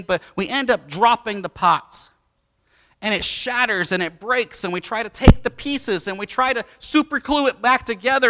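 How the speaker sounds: loud, high, driving sermon build into a prayer; full-voiced pulpit preaching, pleading at the top; preaching at full force. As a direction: preach it out, building to a cry to God. Preaches the broken pot, praying.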